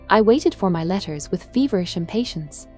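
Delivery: plain reading voice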